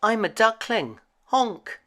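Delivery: duckling answering the cat, small and high; ugly duckling. A narrator's reading. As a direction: pipe up like a little duckling. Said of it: "replied the ugly duckling".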